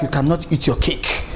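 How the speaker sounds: normal talking voice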